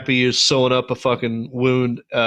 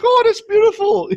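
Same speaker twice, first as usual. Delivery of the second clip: very high, louder mock gush; mock squeak; 5 dB louder than usual. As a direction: squeal it like a gushing fan. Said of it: "It's like".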